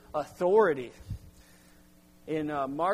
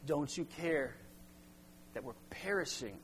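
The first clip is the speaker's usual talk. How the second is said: hushed desperate plea; 10 dB quieter than usual. Acted. Disciples' fearful cry voiced.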